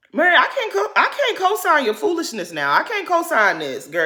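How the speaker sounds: loud, high-pitched exasperated protest; exasperated scolding. Real, not acted. Host scolds the absent Mary.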